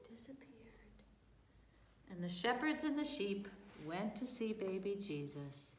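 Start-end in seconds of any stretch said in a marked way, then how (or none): 0.1-1.0 faint vanishing whisper. Acted. Storyteller telling children the angel vanished, whispered. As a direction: let it fade to nothing.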